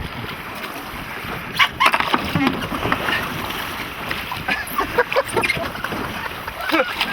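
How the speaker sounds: laughter. Real, not acted. Laughing at mate's antics.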